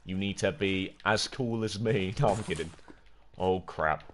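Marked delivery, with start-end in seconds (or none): none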